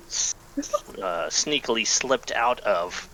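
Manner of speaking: ordinary delivery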